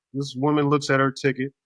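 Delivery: normal talking voice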